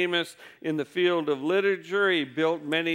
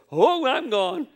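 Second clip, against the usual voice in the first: put-on higher and louder, a frightened kid with a laugh; scared child; 8 semitones higher, 5 dB louder than usual. Preacher voicing the child fleeing from a gruff adult. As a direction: play the kid bolting in mock fright. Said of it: "And they'll go".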